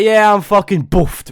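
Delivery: loud, dragged-out wasted slur; imitating slurred speech. Drugged friend answers from the bathtub, imitated slurring. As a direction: play the drugged friend, slow and heavy. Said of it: "sounded like his mouth was falling apart".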